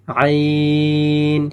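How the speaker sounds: আইন said firmly and chanted long; firm long sound. Shown as a demonstration. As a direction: press আইন hard and hold it four alifs. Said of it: "শক্ত করে হবে এবং চার আলিফ টান হবে". (আইন শক্ত করে চার আলিফ টেনে)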